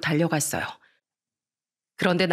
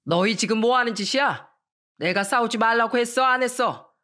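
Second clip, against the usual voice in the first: higher, stern barking father; a hint of an angry father bursting in; dad yelling; 4 semitones higher than usual. Strict father bursting in on fighting kids, lightly voiced angry yell. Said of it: "소리를 버럭 지르셨습니다".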